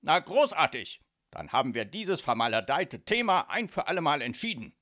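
loud, self-satisfied father; booming, pleased mafia boss wrapping it up; satisfied proclamation. A narrator's reading. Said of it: "strahlte seine Tochter zufrieden an".

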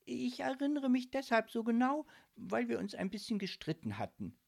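high, hesitant confession fading off; embarrassed. A narrator's reading. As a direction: admit it awkwardly. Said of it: "fügte sie peinlich berührt hinzu".

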